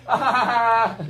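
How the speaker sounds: high mock laugh, "ah"; imitated laughter. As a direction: fake someone cracking up in the background. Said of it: "Just like he's laughing".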